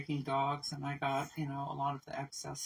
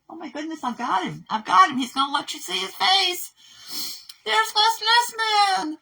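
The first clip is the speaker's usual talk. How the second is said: much higher and louder, squealing at the dog; delighted pet talk; 13 dB louder than usual. Real, not acted. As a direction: gush over the dog like a proud owner. Delighted talking to her dog.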